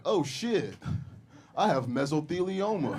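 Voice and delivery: laughing mock cheerful commercial patient; mock ad sufferer. Comedian spoofs injury-lawyer ad, mock cheerful. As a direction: play a cheery ad victim.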